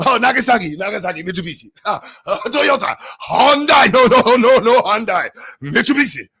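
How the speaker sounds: put-on high-pitched angry Japanese accent parody; mock Japanese accent. Streamer listing Japanese brand names, angry mock Japanese accent. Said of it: "that's the Japanese accent".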